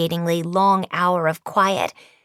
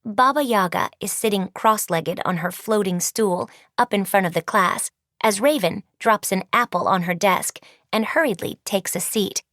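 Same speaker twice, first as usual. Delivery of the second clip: plain reading voice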